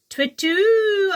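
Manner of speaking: high, loud hooting call; owl hoot. Acted. The owl calling down to Jackal, loud hoot. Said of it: "answered the owl".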